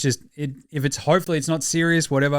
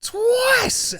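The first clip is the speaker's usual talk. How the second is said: high, drawn-out hammed-up wail; mock outcry; 12 semitones higher, 3 dB louder, much slower than usual. Host announcing the pantsing of the night, hammed-up high wail. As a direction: stretch it out, climbing to a shriek.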